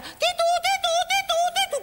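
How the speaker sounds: high, loud sung siren titu titu; playful siren noise by mouth; mock siren. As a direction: imitate a siren with your voice. Imitating car siren by mouth.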